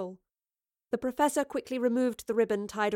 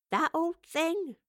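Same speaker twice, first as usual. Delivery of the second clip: drawn-out, higher, scoffing question; dismissive; 8 semitones higher, much slower than usual. A narrator's reading. Evie on her failed teleport, dismissive. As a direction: wave it away with a slow rising lilt. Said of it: "Evie said dismissively".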